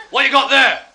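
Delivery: high, loud shouted question; gruffly shouted demand; sergeant's bark. Retold or imitated. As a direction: bellow it from the desk.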